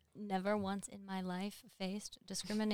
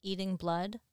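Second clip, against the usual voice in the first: ordinary talk, nothing marked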